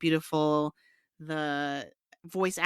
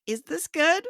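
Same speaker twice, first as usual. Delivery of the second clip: mock doubtful question; 11 semitones higher, slower than usual. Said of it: "people were like".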